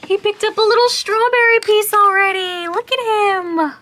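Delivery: high, loud delighted squeal; excited. Real, not acted. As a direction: gush over the cute pet. Delighted at hamster eating.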